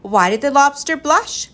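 high, loud riddle call; yelling. A narrator's reading. Ben throws a riddle to the crew, yelling. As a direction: yell the question out to everyone. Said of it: "yelled Ben".

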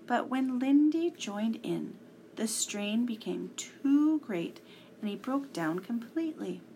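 usual speaking voice

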